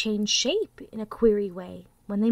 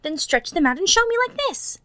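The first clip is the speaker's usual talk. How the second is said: challenging wild rabbit; 10 semitones higher, 8 dB louder than usual. A narrator's reading. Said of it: "said the wild rabbit".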